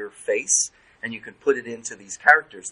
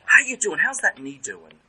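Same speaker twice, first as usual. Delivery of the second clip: a light, friendly phone greeting, voice somewhat raised; mock phone call to a patient; 4 semitones higher, 5 dB louder than usual. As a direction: play the therapist calling an old patient, brightly. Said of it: "I said to therapist".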